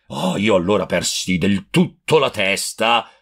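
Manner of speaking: ordinary delivery, nothing marked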